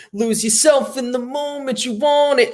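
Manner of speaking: high mock rap line; imitating a rapper. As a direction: mimic the distant rapper. Imitating a stage rapper heard.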